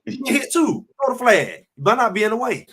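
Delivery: high, loud and worked up about the hit; rally the team, voice climbing; fired up. Real, not acted. Host fired up about hitting Shedeur's opponents, high and loud.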